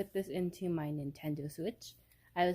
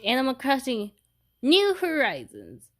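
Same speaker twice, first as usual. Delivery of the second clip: excited announcing; 7 semitones higher, 8 dB louder than usual. Real, not acted. Vlogger starting her new game on camera, excitedly announcing the title.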